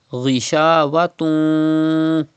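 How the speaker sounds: "Ghishawatun" with a held nasal ending; Gunna. Shown as a demonstration. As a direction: hum out the "tun" of "Ghishawatun". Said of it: "Keep the sound in your nose".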